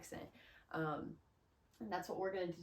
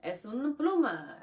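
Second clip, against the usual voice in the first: loud, slow, higher Spanish; Spanish speech; 4 semitones higher, 8 dB louder than usual. Shown as a demonstration. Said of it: "You're gonna speak Spanish".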